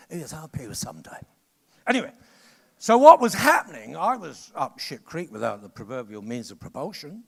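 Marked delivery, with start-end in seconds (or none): 0.1-1.2 hushed mock promise from a non-payer; mocking a client who never pays; mock whisper. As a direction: whisper it like a fob-off.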